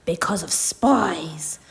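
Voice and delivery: slow hushed whisper; secretive storyteller whisper. Acted. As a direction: breathe it like a secret.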